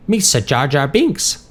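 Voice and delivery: high, drawn-out silly alien voice; goofy character impression with amusement; Jar Jar Binks. A narrator's reading. Marty clowning about Star Wars, goofy mimicry. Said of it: "Marty said with a laugh".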